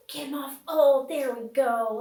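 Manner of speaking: usual speaking voice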